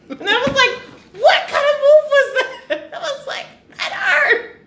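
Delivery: very high squeal through laughter; helpless laughter. Real, not acted. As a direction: squeak the words out while cracking up. Cracking up retelling the punch.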